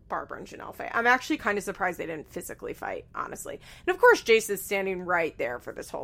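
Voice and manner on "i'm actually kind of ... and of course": ordinary talk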